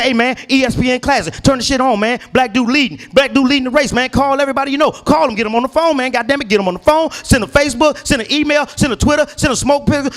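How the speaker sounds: high, rushing excited phone call; hyped call telling everyone to watch. Retold or imitated. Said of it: "I got excited".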